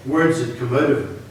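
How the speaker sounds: ordinary delivery, nothing marked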